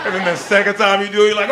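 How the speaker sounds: ordinary delivery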